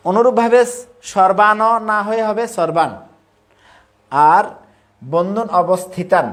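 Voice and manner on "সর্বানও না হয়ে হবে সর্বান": সর্বান ends on n, not সর্বানও; সর্বান with halanta, not সর্বানও; silent final vowel. Shown as a demonstration. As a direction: drop the closing vowel of সর্বান. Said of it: "বর্ণের শেষে হসন্ত থাকে".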